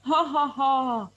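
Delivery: slow, put-on ha ha ha; a staged, slow laugh; mock laugh. Shown as a demonstration. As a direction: laugh it out lazily. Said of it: "You can do like this".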